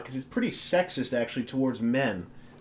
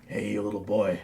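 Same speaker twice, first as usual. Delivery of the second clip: creepy stranger voice; 4 semitones lower than usual. Pretend. Creepy kid-luring voice.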